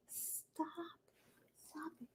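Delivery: whispered stop to the dog; whispered hush. Real, not acted. Owner whispering to quiet her barking dog. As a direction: hiss it under your breath to hush him.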